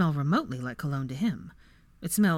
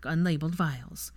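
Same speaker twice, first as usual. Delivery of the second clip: plain reading voice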